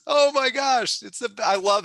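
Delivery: giddy exclamation; elated. Real, not acted. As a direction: burst out in real joy. Gushing about helping people.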